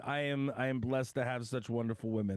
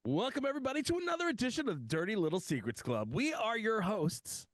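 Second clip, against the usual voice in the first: host voice; 8 semitones higher than usual. Acted. Host opening, raised pitch.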